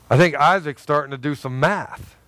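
ordinary talk, nothing marked

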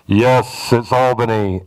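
a deep voice from above; audible voice of God. Retold or imitated. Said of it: "it wasn't an audible".

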